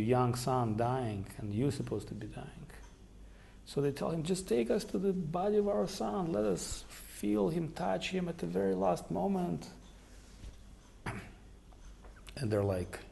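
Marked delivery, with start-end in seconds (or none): 4.2-9.7 grieving parents' request. Retold or imitated. Grieving parents ask for son's body.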